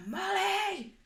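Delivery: high, drawn-out call of a name; nan calling out. Retold or imitated. Nan calls her granddaughter in the shower, high and loud. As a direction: nan shouting up from downstairs.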